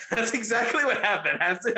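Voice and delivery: normal talking voice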